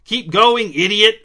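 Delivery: angry shout at the board; angry yell. Acted. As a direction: snap it at the stalled arrow. Man yells at board.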